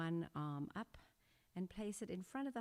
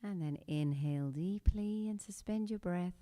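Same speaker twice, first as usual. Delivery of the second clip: guided meditation. Acted. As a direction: guide the class through breath and intention. Leads a breathing meditation.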